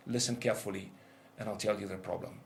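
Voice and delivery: soft, low, calm voice of God; God quietly revealing the answer; still small voice. Retold or imitated. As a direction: speak gently, as God revealing a secret. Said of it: "clear, still voice".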